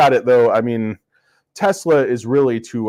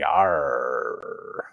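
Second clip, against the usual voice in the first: lightly voiced pirate arr; a mock pirate grunt; a hint of an old pirate; pirate growl; 7 semitones lower, 5 dB quieter than usual. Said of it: "the old pirate said".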